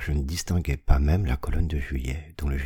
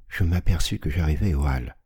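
usual speaking voice

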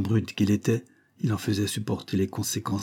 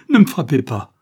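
loud, raised desperate plea; frightened cry; 5 semitones higher, 9 dB louder than usual. A narrator's reading. Child begs not to be beaten, frightened shout. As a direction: shout it in fear, voice falling away. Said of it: "cria l'enfant effrayé".

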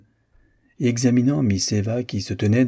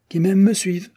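bright rallying call, higher pitched; 10 semitones higher, 4 dB louder than usual. A narrator's reading. Miss Dixon rallying everyone to follow her, gaily. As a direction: call out cheerfully to the company. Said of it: "s'écria Miss Dixon gaiement".

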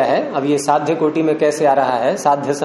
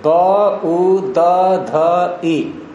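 spelling out sounds; much slower than usual. Shown as a demonstration. Teacher breaks a word down to count its letters, spelling it out. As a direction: separate each sound with a short pause.

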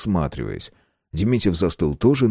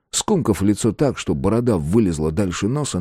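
ordinary delivery

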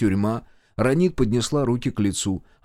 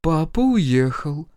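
slow, drawn-out, higher answer; strange, alien voice; 5 semitones higher, 3 dB louder, much slower than usual. A narrator's reading. Mother answers son, in an alien voice. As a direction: answer slowly in someone else's voice. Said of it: "ответила мама чужим голосом".

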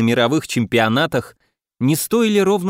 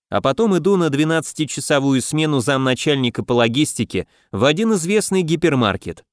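ordinary delivery, nothing marked

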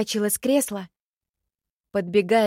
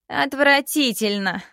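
disgusted; 6 semitones higher, 4 dB louder, slower than usual. Acted. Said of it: "ответила она, выжидающе взглянув".

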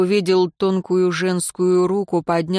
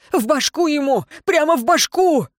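high, urgent yell; frantic yelling; 8 semitones higher than usual. A narrator's reading. Tracker urges archer to aim again, yelling. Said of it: "заорал следопыт".

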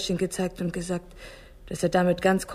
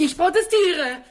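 loud, high, slow protest, nearly shouted; indignant outcry; 9 semitones higher, 5 dB louder, slower than usual. Acted. Mayor cries out against the arrest warrants, loud and indignant. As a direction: burst out in outrage.

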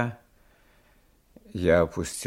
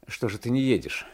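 plain reading voice

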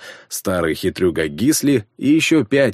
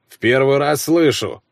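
grumbling; 6 semitones higher, 3 dB louder than usual. A narrator's reading. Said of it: "буркнул Славка".

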